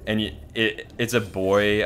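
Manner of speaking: ordinary talk, nothing marked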